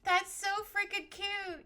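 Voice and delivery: high, drawn-out cute-overload quote; retold gushing. Recalling first seeing it used, retold high gushing. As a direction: re-enact gushing at a high pitch. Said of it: "first time I saw you use it".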